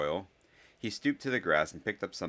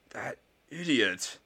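slow, higher, exasperated hiss; angry hiss; 7 semitones higher, slower than usual. A narrator's reading. Draco reacts to Goyle taking off, exasperated hiss. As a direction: stretch it out with contempt. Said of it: "Draco hissed".

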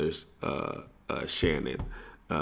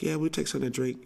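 mock girl voice; 9 semitones higher, faster than usual. Girls answer boys.

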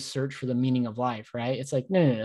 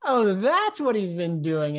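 slow dawning realization; 6 semitones higher, slower than usual. Retold or imitated. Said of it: "And I'm like".

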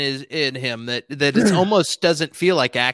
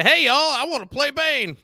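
swagger in like a cowboy on a horse; Texan cowboy; 10 semitones higher than usual. Pretend. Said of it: "I'm from Texas".

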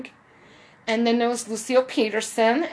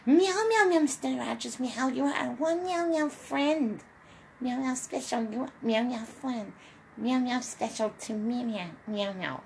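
softly meowing kitty voice; Henrietta Pussycat; 2 semitones higher, 6 dB quieter than usual. Retold or imitated. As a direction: purr it out as a little cat, meow on every phrase. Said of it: "that voice of Henrietta Pussycat".

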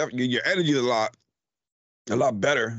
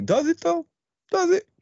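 high teasing doubt; teasing disbelief. Real, not acted. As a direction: poke fun, high and skeptical. Teasing a friend's claim.

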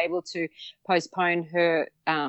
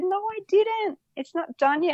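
much higher, stunned denial; disbelief; 12 semitones higher than usual. Retold or imitated. Woman refusing to believe the total was reached, disbelieving. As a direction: protest in giddy disbelief. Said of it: "And I said".

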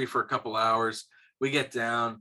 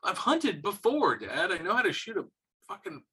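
high-pitched, exasperated protest; indignant retort; 8 semitones higher than usual. Retold or imitated. Kid telling dad they've hunted before, mock indignation. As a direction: act out an offended kid defending their skill.

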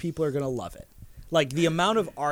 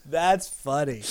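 ordinary talk, nothing marked

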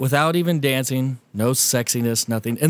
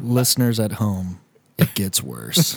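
slow, dropping much lower on the last words; ominous teaser; 4 semitones lower, slower than usual. Acted. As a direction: tease the listeners with a slow, dark hint. Ominous aside to listeners.

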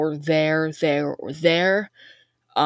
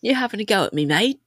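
higher-pitched mock British challenge; badly faked British accent, pitched up; 6 semitones higher than usual. Shown as a demonstration. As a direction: put on a cheeky amateur British accent. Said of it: "I'm working on the British accent".

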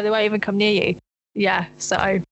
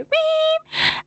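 imitated horn beep. Sounding the horn at oncoming car, high beep. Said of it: "I had to go".

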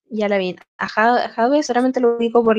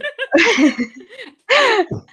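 high burst of laughter; 10 semitones higher than usual. Real, not acted. Laughing at own answer.

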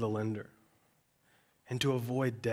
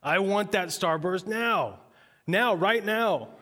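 put-on high, loud, slow demanding kid; pitched-up tantrum yell; toddler tantrum; 7 semitones higher, 5 dB louder, slower than usual. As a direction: stomp it out like a three-year-old. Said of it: "And then it was a battle".

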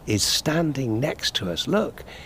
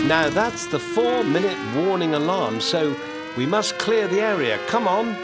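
urgent hurry; 6 semitones higher than usual. Real, not acted. Alarm sounds, hurrying viewers away.

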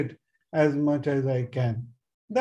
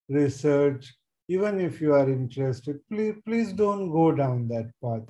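usual speaking voice

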